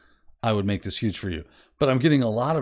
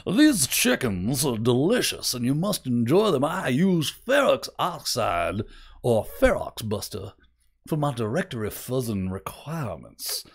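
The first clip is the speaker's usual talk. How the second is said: a hint of an old Southern colonel's accent, savoring each word; Colonel Sanders Southern gentleman. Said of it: "I turned into a Southern gentleman. All of a sudden, I'm like Colonel Sanders".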